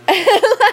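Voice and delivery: high, loud burst of laughter. Real, not acted. Laughs at own retold reaction.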